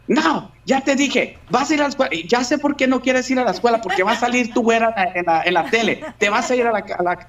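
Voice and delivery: high, scolding Spanish mother; angry mom. Retold or imitated. A mother scolds her son who fakes sick, en español. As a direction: scold him like a fed-up mom. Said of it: "and she's like".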